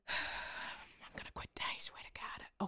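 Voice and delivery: defeated whisper, unvoiced. Real, not acted. Co-host whispering to herself after endless yes answers, defeated whisper. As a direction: breathe it out like giving up. Exasperated aside.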